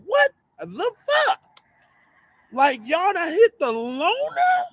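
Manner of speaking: high, slow, stunned disbelief; shocked outrage. Retold or imitated. Driver sees the truck hit his loaner, high shocked outcry. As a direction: stretch it out, stunned. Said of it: "I'm like".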